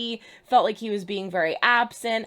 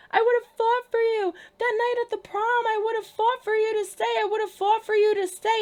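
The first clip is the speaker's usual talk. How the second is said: much higher, mocking girly voice; mocking Belly; 10 semitones higher than usual. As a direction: parrot her lines up high to ridicule them. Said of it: "instead she says".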